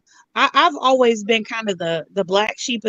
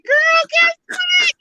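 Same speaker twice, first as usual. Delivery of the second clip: put-on gossipy girlfriend voice; 4 dB louder, much slower than usual. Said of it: "I'll be like".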